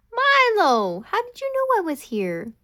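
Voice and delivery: high, loud delighted surprise; surprised. Acted. Ollie greets Milo who found her, loud happy surprise. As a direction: call out, thrilled he found you.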